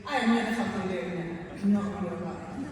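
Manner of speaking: loud, emphatic demonstration of refusing; defiant refusal. Shown as a demonstration. Showing the opposite of compliance, defiant refusal. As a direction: refuse loudly and flatly out. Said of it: "as opposed to".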